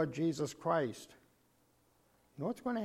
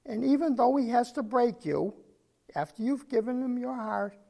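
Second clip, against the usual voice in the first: impassioned rise; 8 semitones higher, 6 dB louder than usual. Real, not acted. Pleading climax of sermon.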